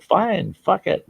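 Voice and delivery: fed-up give-in. Retold or imitated. Exasperated giving up.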